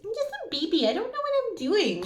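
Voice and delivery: high, whiny baby voice playing dumb; mock a grown-up pleading they're just a baby; helpless baby voice. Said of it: "they're just like".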